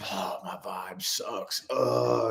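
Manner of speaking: slow, very quiet sigh of defeat; bogged-down groan. Acted. Feeling energetically bogged down, a slow, hushed mock groan. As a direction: groan it under your breath, drained.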